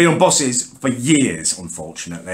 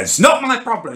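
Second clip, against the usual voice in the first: mock-aggressive jobsworth, pitched well up; aggressive driver; 11 semitones higher than usual. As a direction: sneer it like a stubborn driver. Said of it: "He keeps going aggressively now".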